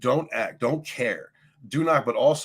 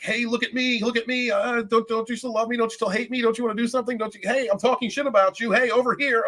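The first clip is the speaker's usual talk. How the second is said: higher mocking whine of an attention-seeking troll; hammed-up hobgoblin begging for attention, much higher; needy narcissist mock voice; 10 semitones higher than usual. Mocking a stalking narcissist for her viewers, higher mock whine. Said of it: "they pop out of the woodwork like".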